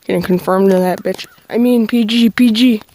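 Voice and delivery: shouted, half-joking swear; loud outburst. Real, not acted. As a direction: blurt it out loud. Loud outburst then correction.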